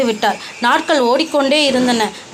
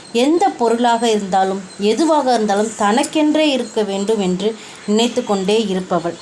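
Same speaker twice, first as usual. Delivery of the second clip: story narration; 6 semitones lower than usual. A narrator's reading. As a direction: tell it gently to children. Narrator reads fairy tale.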